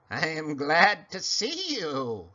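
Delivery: slow, cackling creature voice. Acted. Small creature greets the pig in a slow cackle. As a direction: croak like a little witch. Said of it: "she cackled".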